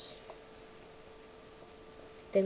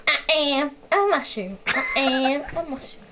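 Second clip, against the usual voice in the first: slightly raised cartoon voice; Smurf; 4 semitones higher than usual. Asked to say it like a cartoon, Smurf voice. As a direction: play a little cartoon creature. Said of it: "Say it like a Smurf".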